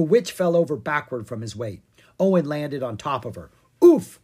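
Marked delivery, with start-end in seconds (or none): 3.8-4.2 very high, loud grunt of impact; knocked breathless by the fall; winded oof. A narrator's reading. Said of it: "she oofed".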